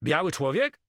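high, loud incredulous question; astonished exclamation. A narrator's reading. Carmo reacts to news of the corpse, exclaiming in surprise. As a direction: cry it out in surprise. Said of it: "wykrzyknął Carmo".

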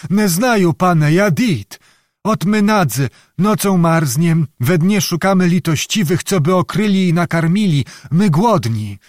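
put-on high, pleading old peasant voice; pitiful old beggar. Old beggar begs the questioning lord for pity, high and whining. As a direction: plead misery to dodge the question.

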